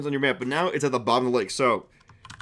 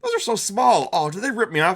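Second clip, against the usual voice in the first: surprised barkeep; 8 semitones higher, 5 dB louder than usual. Acted. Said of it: "he's like".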